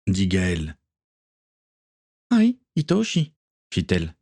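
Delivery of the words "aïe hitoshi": put-on high, light young woman's reply; Akiko's voice. A narrator's reading. Akiko answering captain Gaël, high light voice. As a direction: answer the captain brightly, much higher.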